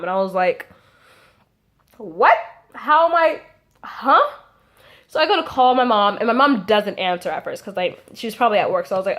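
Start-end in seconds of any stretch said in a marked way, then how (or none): 2.0-4.2 high, halting disbelief; acted-out confusion, rising into a "huh?"; baffled. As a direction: react as if wrongly accused. Acts out being baffled by teacher.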